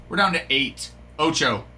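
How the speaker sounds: normal talking voice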